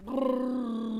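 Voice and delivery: shudder noise. Retold or imitated. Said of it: "And I'm like".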